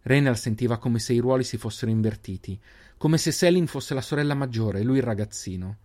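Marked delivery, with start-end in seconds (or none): none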